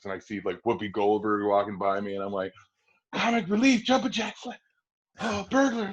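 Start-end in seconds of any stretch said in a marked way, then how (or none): 3.1-5.9 high, excited fan gushing; starstruck excitement. Retold or imitated. Boy spots Whoopi Goldberg in the hall, much higher, starstruck. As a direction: rattle off her films starstruck. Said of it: "I'm, like, flipping out".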